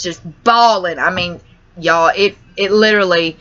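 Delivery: ordinary delivery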